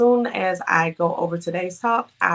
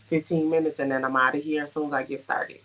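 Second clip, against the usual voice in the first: ordinary delivery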